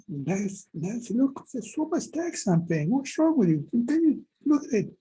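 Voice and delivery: rising alarm, begging her to look; exasperated plea. Retold or imitated. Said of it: "So when I said".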